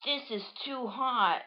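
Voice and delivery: drawn-out high grumble; Bounce's voice. Acted. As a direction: whine it slowly as a cartoon character. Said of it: "says Bounce inside the hand".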